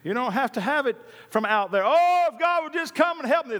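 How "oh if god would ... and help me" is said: high, pleading beggar voice; whiny beggar. Retold or imitated. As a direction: whine it like a beggar. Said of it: "does not talk like that".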